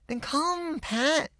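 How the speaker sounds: put-on high, eager little girl voice; little girl coaxing. A narrator's reading. Little girl inviting her friend home, high and coaxing. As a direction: coax him to come along. Pan's voice.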